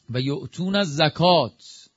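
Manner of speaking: slow, measured recitation of the Arabic verse; Quranic recitation. Shown as a demonstration. Preacher reciting the verse to the congregation, slowly intoned. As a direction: intone الزکات slowly, falling at the end.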